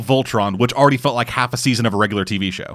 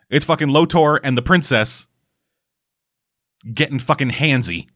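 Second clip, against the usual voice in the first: usual speaking voice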